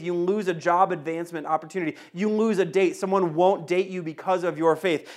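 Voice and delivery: normal talking voice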